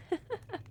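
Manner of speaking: light, quiet mocking giggle; soft giggle. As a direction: giggle softly at a struggling victim. Laughs at victim's struggle.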